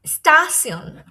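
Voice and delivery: "স্টাসিয়ন" said loud, ending in "si-on"; German -tion ending. Shown as a demonstration. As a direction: model "স্টাসিয়ন" with an s, not sh. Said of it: "এটার উচ্চারণটা হবে সিয়ন".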